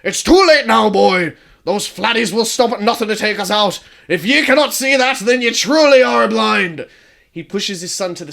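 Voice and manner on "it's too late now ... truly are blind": much louder, higher rough father voice, slowing at the end; father shouting down his son; booming clan leader. Acted. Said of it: "his father's booming voice".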